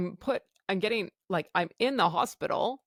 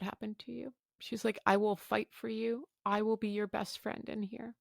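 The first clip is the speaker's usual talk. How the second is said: softer, reassuring doctor; gentle persuasion; 7 dB quieter than usual. Retold or imitated. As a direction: coax her softly to stay.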